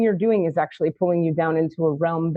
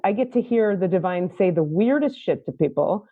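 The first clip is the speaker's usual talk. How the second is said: ordinary talk, nothing marked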